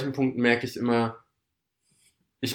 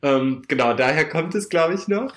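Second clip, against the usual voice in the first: laughing; 6 semitones higher than usual. Real, not acted. Talks on through laughter.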